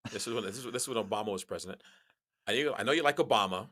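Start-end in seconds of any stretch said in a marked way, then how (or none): 2.5-3.7 louder, fast, pushy mock barfly; mock drunk accusation. Drunk man at hotel bar lecturing a stranger, loud. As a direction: jab at him like a tipsy stranger at the bar. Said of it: "He goes, um".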